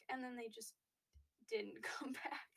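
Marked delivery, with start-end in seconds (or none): 1.5-2.5 laughing whisper. Real, not acted. Laughing aside.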